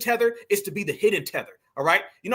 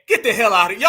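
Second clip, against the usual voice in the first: louder, dismissive scoff; scoffing outburst; 7 dB louder, faster than usual. Real, not acted. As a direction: scoff at her out loud. Scoffing at clip's claim.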